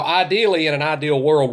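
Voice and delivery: ordinary talk, nothing marked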